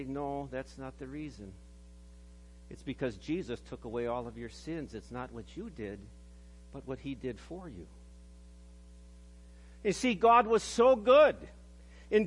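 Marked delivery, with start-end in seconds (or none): 0.0-7.9 calm, low and much softer, gently reassuring; very calmly. Retold or imitated. Pastor reassuring a dying grandma, calm and soft. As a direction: settle her fears with quiet calm. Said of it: "And then very calmly I have to say".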